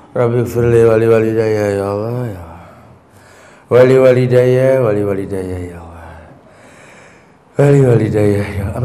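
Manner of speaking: low, repeated, fading moaned plea; desperate pleading prayer.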